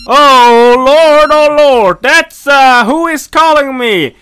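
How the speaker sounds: loud, high panicked outcry; frightened cry. Real, not acted. Player jumping at a ringing phone, loud panicked cry. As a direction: yelp at the sudden ringing. Phone suddenly rings in the dark.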